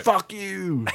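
high-pitched playful jab, not in earnest; mock outrage, lightly squealed; playful swearing. Fan retelling an angry tweet, playfully. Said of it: "it was a playful fuck you".

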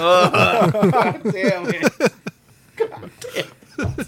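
wheezing laughter, high; helpless laugh cracking the words. Real, not acted. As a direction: choke it out through laughter. Laughing fit after a joke.

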